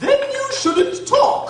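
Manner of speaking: rude retort. Acted. Hatter snubs Alice mid-quarrel, loud scornful retort. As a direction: slap her down with the line.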